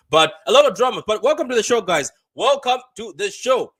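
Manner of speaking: loud, high hype greeting; excited welcome. Real, not acted. Host loudly welcoming stream viewers, excited. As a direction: pump up the audience as you open.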